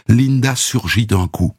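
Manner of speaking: ordinary delivery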